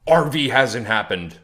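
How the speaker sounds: mock-read viewer comment "R V hasn't happened"; reading a chat comment. Reading out a doubting viewer's comment in a put-on voice. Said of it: "here's this one over here".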